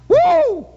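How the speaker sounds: a huge high whoop of joy bursting out; ecstatic whoop. Real, not acted. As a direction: let out a wild high yelp of glory. Preacher whoops in fervour.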